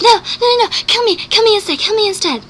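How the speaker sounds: high, desperate begging; frantic pleading. Retold or imitated. Bella begs the vampire to kill her instead, high and frantic. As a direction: beg them to take you instead.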